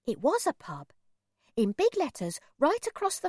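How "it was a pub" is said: ordinary delivery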